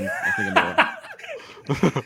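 laughing. Real, not acted. Laughs at own cocky answer.

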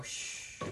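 a breathy unvoiced shh; whispered shush. Shown as a demonstration. Showing a subtler trick, a whispered shush. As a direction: hush it out without voice. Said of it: "kinda go".

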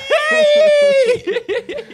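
high loud burst of laughter. Real, not acted. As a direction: crack up loudly. Laugh after joke.

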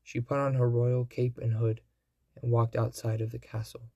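usual speaking voice

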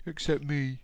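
high, plaintive storybook reply; sighing sadly. A narrator's reading. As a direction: sigh it out in mild self-pity. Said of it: "sighed deeply".

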